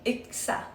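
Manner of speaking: "Yxa" with a rounded front y, lips pursed; Swedish pronunciation. Shown as a demonstration. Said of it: "Here are some practice words".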